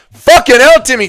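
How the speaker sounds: loud, high yelp of delight; a sudden loud whoop at the donation; excited outburst. Real, not acted. Streamer yells with joy at a surprise donation, loud and high.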